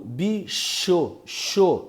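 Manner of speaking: bicho and xô with the ch sound set apart; demo words. Shown as a demonstration. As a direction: show the single sh sound. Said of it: "é o único som". ("bicho" e "xô" destacando o som do "ch")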